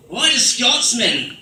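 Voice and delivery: put-on slow, drawn-out Scottish accent. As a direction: put on a Scottish burr, stretching the words. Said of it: "only make sense if it's in that accent".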